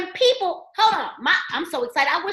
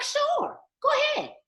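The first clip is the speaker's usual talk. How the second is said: mock dismissive; 14 semitones higher than usual. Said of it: "So they like".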